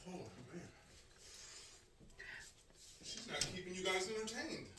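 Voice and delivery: calling from another room; distant voice from offscreen; far off. Real, not acted. Went off to get water.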